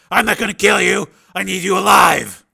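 forceful, loud and high; shouted command. Acted. Steele needs the cornered gunman alive, shouted. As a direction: shout him out of shooting. Armed standoff, ordering captive to disarm.

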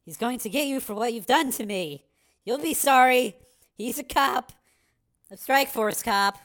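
put-on high-pitched, defiant girl; tearful threat. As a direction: warn the bully, upset but sure. Crying girl threatens her captor.

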